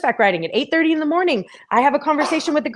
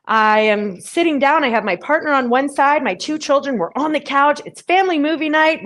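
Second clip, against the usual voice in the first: ordinary delivery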